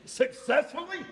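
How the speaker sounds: laughing disbelief, pitch up; incredulous. Real, not acted. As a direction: question it with a laugh. Host doubts claim of success.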